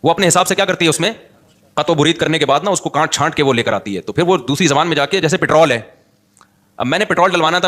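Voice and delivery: usual speaking voice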